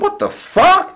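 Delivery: sudden high squeak of disbelief; imitating a shocked high yelp; mock disbelief. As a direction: jump way up as if floored. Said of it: "I'm like".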